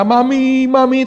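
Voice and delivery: high, loud crying for mama; mock whining child. Wife crying to go home to mama, hammed-up whine. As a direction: whine it like a homesick kid. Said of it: "dia nangis terus".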